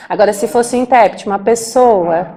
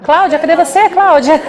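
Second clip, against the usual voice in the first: higher-pitched, playful call for someone missing; mock urgent calling; 6 semitones higher, 3 dB louder, faster than usual. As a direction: call out as if hunting for her, half laughing. Acted call to an absent interpreter. (chamado de brincadeira, aflito e agudo)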